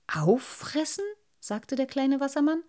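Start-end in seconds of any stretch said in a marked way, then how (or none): 0.1-1.0 put-on higher, eager boyish voice; a proud little boy defending his finds; little water sprite. Little Wassermann echoes.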